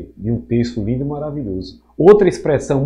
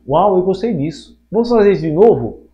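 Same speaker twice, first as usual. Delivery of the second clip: higher, louder mock enthusiasm; acted delight; 6 semitones higher, 5 dB louder than usual. Teacher voices early humans enjoying repeated phrases, with acted excitement. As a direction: play someone thrilled by a new discovery.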